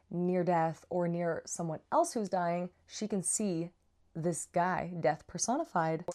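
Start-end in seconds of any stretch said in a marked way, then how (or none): none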